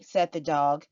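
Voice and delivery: ordinary delivery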